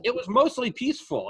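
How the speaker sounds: high, sarcastic mock reporter voice; mock the reporter by the fire; sarcastic imitation of a TV reporter. Mocking news reporter's line.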